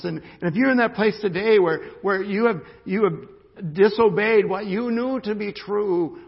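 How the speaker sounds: high, loud pulpit build; preaching at full force. Real, not acted. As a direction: press the truth home to the congregation.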